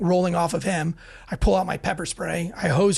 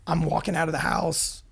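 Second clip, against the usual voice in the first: ordinary talk, nothing marked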